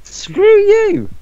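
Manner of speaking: high, loud "Screw you!" shouted; outraged yell. Real, not acted. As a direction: yell it back, high and indignant. Friend mock-outraged at teasing.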